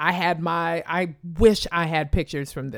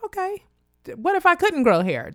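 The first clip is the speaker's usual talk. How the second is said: challenging question; 11 semitones higher, 4 dB louder than usual. Retold or imitated. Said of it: "And I'm like".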